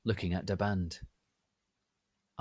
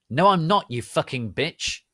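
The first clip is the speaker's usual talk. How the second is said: loud, high furious yell; angry shout; 8 semitones higher, 11 dB louder than usual. A narrator's reading. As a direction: snap back in fury. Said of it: "he shouted angrily".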